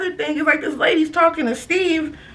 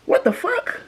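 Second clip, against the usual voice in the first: incredulous; 11 semitones higher, slower than usual. Real, not acted. Disbelief at Steve retiring.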